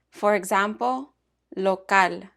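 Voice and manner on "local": "local" said in Spanish as "lo-CAL", stress on the last syllable; Spanish pronunciation. Shown as a demonstration. Teacher models a Spanish -al word for learners, Spanish pronunciation. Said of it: "stressed on the last syllable".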